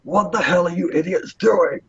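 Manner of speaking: exasperated commander calling out; yelling out. Acted. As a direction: bark at your men in a high voice. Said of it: "And he yells out".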